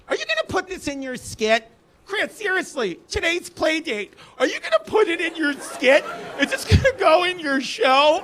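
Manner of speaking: high, whiny mom imitation; shrill mom impression, the voice jacked up high; mocking mother voice.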